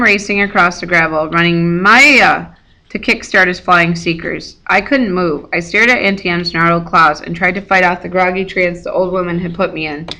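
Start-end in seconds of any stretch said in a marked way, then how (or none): none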